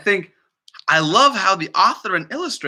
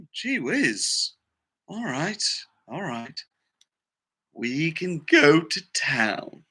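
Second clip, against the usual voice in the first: much softer, slow, defeated; pony finally agreeing to go, deflated; giving in quietly; 6 dB quieter, much slower than usual. Acted. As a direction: let the fight drain out and sigh it. Pony gives in.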